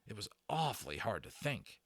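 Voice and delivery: plain reading voice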